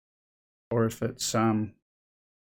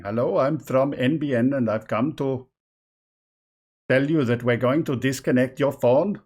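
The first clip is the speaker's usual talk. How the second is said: a louder, hammed-up Indian accent as a scam caller; mock Indian call-center accent; 2 semitones higher, 6 dB louder than usual. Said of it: "I can't do a very good accent".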